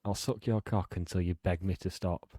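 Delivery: mock seductive phone-card voice; sensual whisper. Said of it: "leaned into the mic then and said it... sensual".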